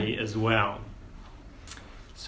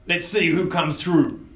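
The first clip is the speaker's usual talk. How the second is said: mild higher goblin boss voice; a hint of a goblin boss; 6 semitones higher, 3 dB louder than usual. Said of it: "in his goblin voice".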